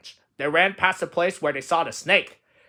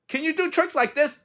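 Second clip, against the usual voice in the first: very high-pitched question; boastful cry; 12 semitones higher than usual. Acted. Toad shows Frog his tricks, high excited cry. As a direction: show off in a high voice. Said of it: "cried Toad".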